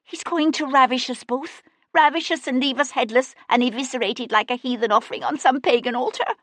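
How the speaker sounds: panicked maid. A narrator's reading. Hysterical maid fearing ravishment.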